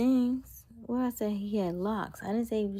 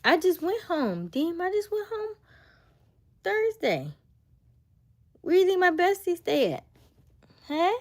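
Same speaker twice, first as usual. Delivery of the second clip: high mock voice; 13 semitones higher, 7 dB louder than usual. Mocking someone's bragging.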